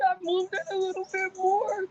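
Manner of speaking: very high mock-crying voice; tearful report. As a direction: imitate a weepy progress update. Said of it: "I'm crying the whole time".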